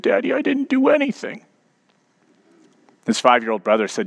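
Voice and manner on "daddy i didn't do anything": put-on high little-boy plea, on the verge of tears; quivering lip. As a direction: imitate a hurt four-year-old pleading with dad. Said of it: "his lip still quivering".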